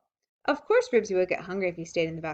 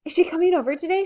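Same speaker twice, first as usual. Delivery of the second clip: much higher, louder eager question; 10 semitones higher, 7 dB louder, faster than usual. A narrator's reading. Beezus eagerly asking Mother about her aunt, high and loud. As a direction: ask it all lit up. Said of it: "she asked eagerly".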